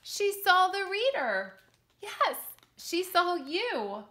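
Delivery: spring the surprise on the listener; excited reveal. Acted. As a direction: reader telling the child they are in the story, excited. Storyteller reveals the reader.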